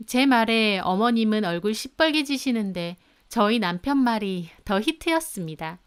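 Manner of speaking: ordinary delivery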